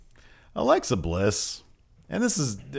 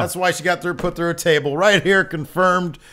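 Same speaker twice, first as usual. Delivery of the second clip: raised, bold announcement; excited; 8 semitones higher, 6 dB louder than usual. Real, not acted. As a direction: hype up the scoop. Announcing exclusive news.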